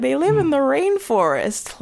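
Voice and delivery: put-on high, slow childlike answer; imitated schoolkids. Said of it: "all the kids were like".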